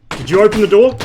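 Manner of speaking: high, loud urgent shout; alarmed shout. Real, not acted. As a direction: yell it out in alarm. Sudden alarm at opened door.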